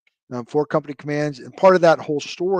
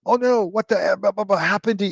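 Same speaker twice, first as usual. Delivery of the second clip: higher-pitched mock cursing, words blurred into blahs; imitated cursing; 5 semitones higher than usual. Colonel reacting to his old jump master, mock cursing. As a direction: act out a colonel's astonished cursing. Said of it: "you know, cursing".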